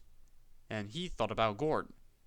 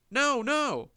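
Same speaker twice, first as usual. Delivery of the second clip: high, louder wailing cry; 12 semitones higher, 6 dB louder than usual. A narrator's reading. Empty trucks wailing as the loaded ones pull, high. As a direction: wail it in alarm. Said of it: "wailed the empty trucks".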